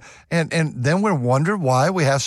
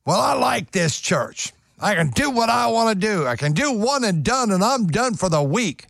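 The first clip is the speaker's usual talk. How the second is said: higher, smug mock churchgoer; 7 semitones higher than usual. Preacher mimicking a lazy churchgoer, higher and smug. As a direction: sneer out a lazy churchgoer's excuse. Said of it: "mocking a churchgoer".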